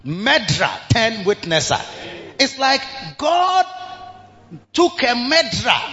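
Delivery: usual speaking voice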